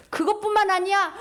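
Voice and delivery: ordinary delivery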